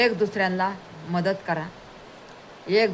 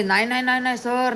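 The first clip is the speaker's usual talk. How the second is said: raised, louder insistent no no no; pleading with the master not to do the work, raised and insistent; emphatic refusal; 5 semitones higher, 4 dB louder than usual. Retold or imitated. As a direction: protest politely but firmly, voice pitched up. Servant refusing the master's help.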